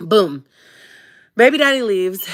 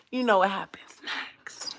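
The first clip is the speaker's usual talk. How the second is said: soft, suggestive whispered aside; confiding whisper; 9 dB quieter than usual. Real, not acted. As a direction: whisper it like a juicy secret.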